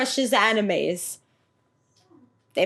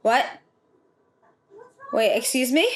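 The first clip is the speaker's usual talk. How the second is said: ordinary talk, nothing marked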